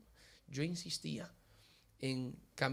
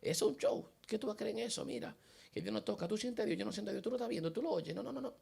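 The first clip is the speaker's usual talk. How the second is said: mocking mother; 6 semitones higher, 3 dB quieter than usual. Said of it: "Y decía".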